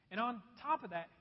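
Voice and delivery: usual speaking voice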